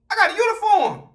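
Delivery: high thinking-to-self voice; high inner voice. Retold or imitated. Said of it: "in my mind".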